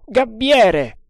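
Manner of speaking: high, loud hail; shouted call. A narrator's reading. Calling up to the lookout on the mast, shouting. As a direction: holler up to someone far overhead. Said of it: "gridò all'indiano".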